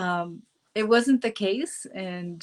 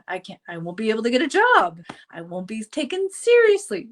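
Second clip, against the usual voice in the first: worried protest; 3 semitones higher, 7 dB louder, faster than usual. Retold or imitated. Acting out past worries.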